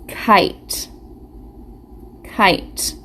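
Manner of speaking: "Kite" whispered with a drawn-out long i; stretched long I. Shown as a demonstration. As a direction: sound out "Kite" softly, stretching the i. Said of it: "does that sound long".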